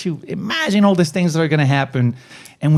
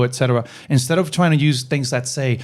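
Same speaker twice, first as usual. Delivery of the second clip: normal talking voice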